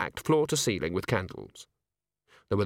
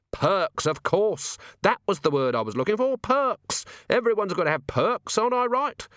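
hearty; 10 semitones higher than usual. Acted. Vimes mock-hearty realization.

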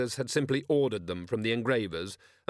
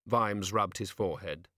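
usual speaking voice

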